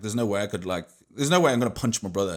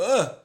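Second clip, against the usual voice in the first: mock grunt; 13 semitones higher, 6 dB louder than usual. Fake effort grunt while provoking.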